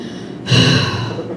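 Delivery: long unvoiced sigh of gloom; long depressed mock sigh; mopey sigh. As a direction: sigh like a defeated husband. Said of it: "Then there's depression".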